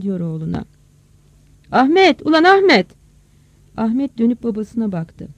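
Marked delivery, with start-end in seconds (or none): 1.7-2.9 loud, high shout of a name; calling out. Acted. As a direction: holler for your son impatiently. Father calls out to his son. (oğluna bağırarak seslenme)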